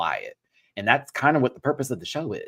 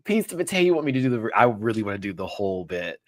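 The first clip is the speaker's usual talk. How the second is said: ordinary talk, nothing marked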